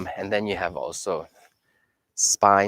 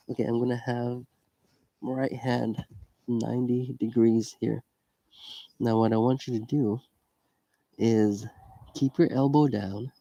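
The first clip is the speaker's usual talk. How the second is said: whispering; 6 dB quieter than usual. Real, not acted. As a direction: talk softly so a nearby person isn't disturbed. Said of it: "Now I'm going to whisper".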